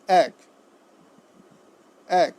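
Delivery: এক as a short, separate "æk"; correct pronunciation. Shown as a demonstration. Teacher answering for students, এক said "æk". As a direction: open the vowel of এক to "æ". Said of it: "উচ্চারণটি কী হবে?".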